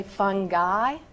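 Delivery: "fungi" as "fun-guy", hard G. Shown as a demonstration. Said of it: "it is not fungi".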